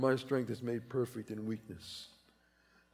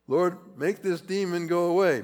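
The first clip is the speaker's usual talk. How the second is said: voicing Paul's plea to the Lord, a touch louder and higher; pleading prayer; 5 semitones higher, 6 dB louder than usual. Paul begging the Lord to remove the demon, pleading. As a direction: beg God to take it away. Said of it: "He's saying".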